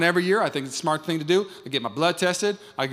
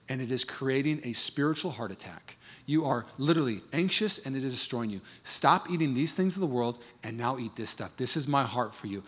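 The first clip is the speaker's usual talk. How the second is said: softer, lower pleading of a caring doctor; tender, intimate; 4 semitones lower, 6 dB quieter than usual. Retold or imitated. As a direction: speak it gently, like a loving father. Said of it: "is saying".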